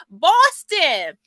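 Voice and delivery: hammed-up Boston accent greeting; mock Boston accent. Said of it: "That's probably the wrong accent".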